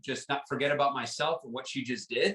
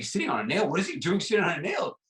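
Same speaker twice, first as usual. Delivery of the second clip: mock disbelief, rising higher into the second question; incredulous visitor; 5 semitones higher, faster than usual. Visitor echoing the dog's owner, acted incredulity. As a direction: throw it back as a baffled question.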